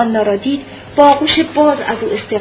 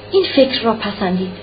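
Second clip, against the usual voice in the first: ordinary delivery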